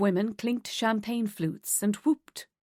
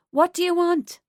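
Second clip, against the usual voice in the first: higher and louder, calling over noise; shouting over music; 9 semitones higher, 6 dB louder than usual. Acted. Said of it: "Tara shouted in Cassie's ear".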